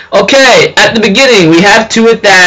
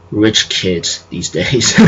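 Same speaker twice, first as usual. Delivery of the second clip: very low, much softer and slow; sardonic grumble; 11 semitones lower, 9 dB quieter, much slower than usual. Retold or imitated. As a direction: drawl it under your breath, mock disapproving. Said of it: "I'm like".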